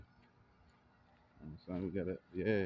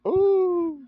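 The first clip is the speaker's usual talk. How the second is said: much higher, much louder ooh; excited loud ooh; hyped ooh; 10 dB louder than usual. Real, not acted. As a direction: shriek the ooh in delight. Reacting loudly.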